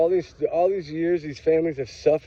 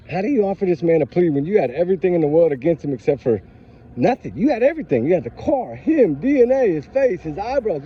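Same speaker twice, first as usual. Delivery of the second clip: real fired-up rant, pitched higher; higher-pitched, outraged challenge to the system; 4 semitones higher than usual. Real, not acted. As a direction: let the indignation push your voice up. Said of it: "I'm fired up. I'm sorry".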